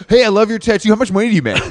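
high, excited street-interview voice; mock TikTok interviewer. Co-host parodying a TikTok money question, high mock-eager voice. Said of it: "like I was doing a TikTok".

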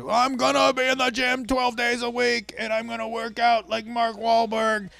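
high, airy voice mocking big promises; mock your own grand resolutions; overconfident dreamer. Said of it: "a mistake we make all the time is we said".

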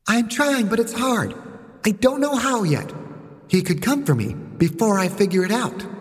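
put-on high little-girl voice; a small girl protesting that she is struggling; child figure, worried.

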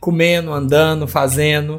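"comendo, andando, fazendo" without the D; Minas dropped consonants. Shown as a demonstration. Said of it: "as consoantes no gerúndio também".

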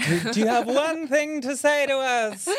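high, loud, laughing ghost hunter; mock ghost hunter. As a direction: squeal it like a nervous hunter. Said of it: "ghost hunter voice".

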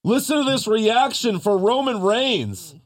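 mocking Corey Graves's call; a hint of a commentator. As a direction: quote the commentator with mock drama. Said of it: "Corey Graves says".

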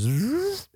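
quick buzzy zip; imitated zipping noise. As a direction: make the car's whoosh-out sound. Imitates car being pulled out.

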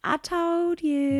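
slow, smug drawl. Real, not acted. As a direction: gloat slowly. Smug after being right.

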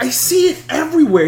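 high, wide-eyed voice; a stranger's amazed high voice. Retold or imitated. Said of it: "He's like".

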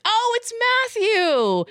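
high, delighted mock recognition; surprised recognition. Fan seeing Matthew on screen again, surprised recognition. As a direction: exclaim it high, spotting a familiar face.

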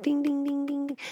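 high sing-song ding ding like a quiz bell; game-show ding. Acted. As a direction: chime it out like a correct-answer buzzer. Game-show bell for right answer.

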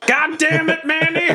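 very high-pitched scolding cry; hammed-up high exasperated yell; exasperated outburst. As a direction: holler in outrage at a comrade. Character exclaims on seeing the attack.

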